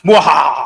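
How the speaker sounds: loud evil cackle; mad scientist. Pretend. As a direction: cackle like a gloating villain. Villainous gloat at the detractors.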